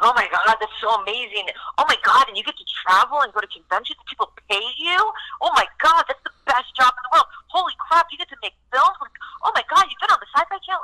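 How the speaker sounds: high, excited starstruck gush; mock gushing fan. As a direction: gush like an awestruck stranger. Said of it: "that are like".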